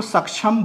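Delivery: सक्षम with a छ in place of क-श; wrong pronunciation. Shown as a demonstration. Teacher warns about saying it so, mispronounced. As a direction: stress सक्षम said the mistaken छ way. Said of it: "वो छ वाला जो है".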